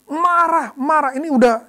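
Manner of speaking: remembering the slanderer's name, flaring up loudly; rising anger. Retold or imitated. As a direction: let the anger flare in the voice. Recalling boiling rage.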